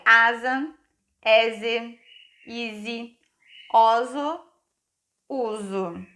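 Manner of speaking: "asa, eze, ise, oso, uso" one by one, each with a buzzed Z; S sounded as Z. Shown as a demonstration. Teacher drills pupils on the rule, Z sound in "asa, eze, ise, oso, uso". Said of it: "ele pega o som do Z emprestado".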